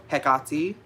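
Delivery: Hecate as heh-KAH-tee, slow, rising, middle stressed; own pronunciation. Shown as a demonstration. Witch giving the form she uses, own pronunciation. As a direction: draw out Hecate, strong KAH, ending in tee. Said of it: "which is usually sometimes how I pronounce it".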